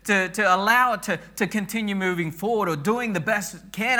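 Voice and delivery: rally the congregation like a team captain; preaching at full force. Acted. Pastor voicing the church's team spirit, preached loud. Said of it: "where we say".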